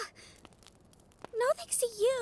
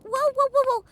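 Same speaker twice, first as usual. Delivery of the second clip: loud alarmed calming cries; startled loud soothing shout; loud whoa; 5 semitones higher, 11 dB louder than usual. Acted. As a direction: stop them from bolting. The human panics, Briar calms them.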